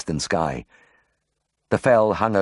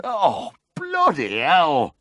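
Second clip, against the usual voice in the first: pained moan; 10 semitones higher, much slower than usual. Acted. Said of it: "he moaned".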